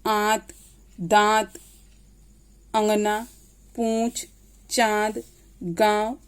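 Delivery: आंत, दांत, चांद with the vowel sounded through nose and throat; word list said evenly, vowels nasalised. Shown as a demonstration. Teacher shows students the nose-and-throat sound, nasalised vowels. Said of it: "उच्चारण नाक और गले दोनों की सहायता से".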